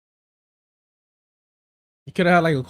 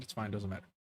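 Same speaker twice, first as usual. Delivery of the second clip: quiet shrug-off; 6 semitones lower, 15 dB quieter than usual. Retold or imitated. Retold brushing off the waiter.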